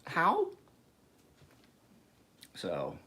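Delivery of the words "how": very high, drawn-out disbelief; stunned one-word question. Retold or imitated. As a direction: squeak it in sheer bafflement. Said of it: "you're like".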